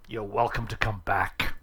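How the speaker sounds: usual speaking voice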